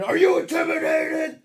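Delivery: high, loud yelled challenge; screaming madman impression. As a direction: scream it like an unhinged motivational speaker. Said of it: "And then he'd go again".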